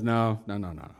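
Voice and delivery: low, fading string of refusals; husband quietly declining; wary refusal. Acted. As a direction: back away softly, dropping to a murmur. Acted husband refusing warily.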